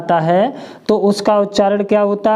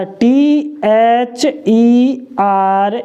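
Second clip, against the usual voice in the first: letters spelled slowly one by one; patient letter-by-letter spelling; 2 semitones higher, much slower than usual. Shown as a demonstration. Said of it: "इसको हम क्या पढ़ते हैं".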